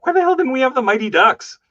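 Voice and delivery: high, fast, loud disbelieving cry; exasperated outburst. Retold or imitated. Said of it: "I'm like".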